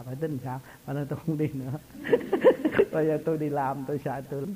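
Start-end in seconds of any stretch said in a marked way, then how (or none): 1.8-2.9 high, loud burst of laughter. Real, not acted. Cười khi kể chuyện tiền. (cười to giòn)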